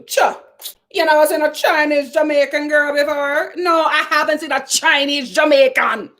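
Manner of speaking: high, badly faked Jamaican accent; hammed-up Jamaican lilt; terrible Jamaican accent. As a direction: imitate a Jamaican exchange with attitude. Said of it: "I did that terrible accent".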